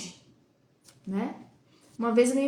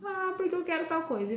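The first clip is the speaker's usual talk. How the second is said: put-on a light, higher child's voice, pleading; mimicking a whiny child; 7 semitones higher than usual. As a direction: mom imitating her son asking for something, lightly raised child voice. Mother voices her whining son.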